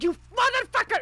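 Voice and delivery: high, loud, angry yell; screamed curse. Acted. Character carrying a friend to safety, screamed curse. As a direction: yell it in exasperated fury. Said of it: "screaming in Dwarvish and Primordial".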